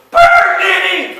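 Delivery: huge, high shout with a falling end; group shout demo. Shown as a demonstration. Teacher showing students the line, very high loud shout. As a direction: roar it out to show the class. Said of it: "pick Nene up and go".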